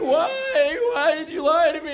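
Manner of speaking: put-on loud high-pitched child's wail; hammed-up tearful little girl; mock crying. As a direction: plead through exaggerated sobs as a betrayed kid. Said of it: "and she's crying".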